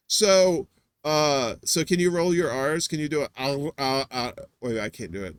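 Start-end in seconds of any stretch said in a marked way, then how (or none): none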